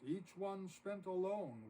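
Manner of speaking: ordinary delivery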